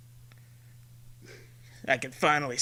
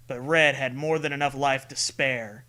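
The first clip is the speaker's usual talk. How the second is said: ordinary delivery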